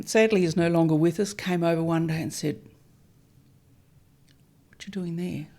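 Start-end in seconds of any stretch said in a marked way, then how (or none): 4.7-5.5 quietly voiced neighbour, no accent attempted; hushed neighbour quote. Neighbour asking over the fence, in a hushed voice. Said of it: "I can't do a Greek accent".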